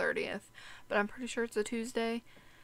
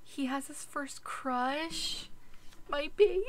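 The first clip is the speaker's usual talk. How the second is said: high slow cooing; doting; 6 semitones higher, slower than usual. Real, not acted. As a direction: coo it like a proud parent. Doting over sim crush.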